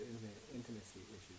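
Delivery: low, very soft imitation; mimicking dropped voice. Quoting a colleague's odd voice change, low mimicry. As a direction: quote it back low and quiet. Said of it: "Why did your voice change there?".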